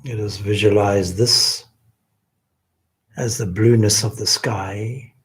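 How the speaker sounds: ordinary delivery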